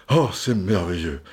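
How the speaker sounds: usual speaking voice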